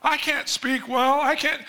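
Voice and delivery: high, slow, whiny protest; a reluctant man whining at God; whining Moses. Acted. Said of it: "Moses, quit whining".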